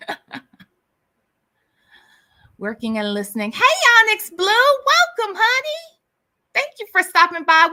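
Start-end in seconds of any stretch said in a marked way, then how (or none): 3.5-7.7 a loud, much higher welcome; high, loud excited greeting. Real, not acted. Greeting viewer in chat.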